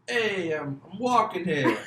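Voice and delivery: loud, high, hammed-up New York accent. Shown as a demonstration. Showing off a bad New York accent first, loud New York accent. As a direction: shout it like a bad New Yorker. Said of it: "I'll do a New York one first".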